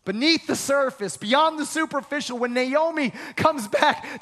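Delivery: normal talking voice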